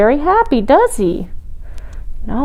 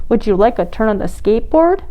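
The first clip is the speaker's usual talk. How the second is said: ordinary delivery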